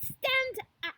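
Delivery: loud, high command; barked order. A narrator's reading. Trunchbull orders Matilda to her feet, barking. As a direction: bark it like a fierce headmistress. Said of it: "she barked".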